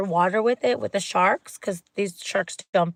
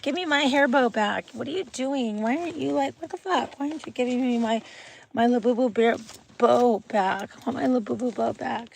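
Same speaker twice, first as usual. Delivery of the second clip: higher-pitched pretend toy voice; indignant Labubu doll; 4 semitones higher than usual. Labubu doll demanding its hair bow back, indignant little voice. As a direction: play a toy whining for its things. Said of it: "My little boo boo's like".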